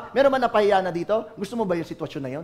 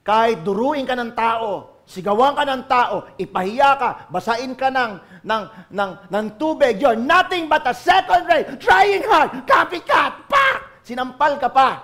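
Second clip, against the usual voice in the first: mounting shout; 4 semitones higher, 6 dB louder than usual. Acted. Acting out an insult scene.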